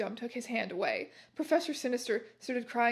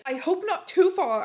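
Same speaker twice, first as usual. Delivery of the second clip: high, loud mock-angry retort; angry shout; 7 semitones higher, 7 dB louder than usual. A narrator's reading. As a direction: shout it back with hammed-up anger. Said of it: "I shouted angrily".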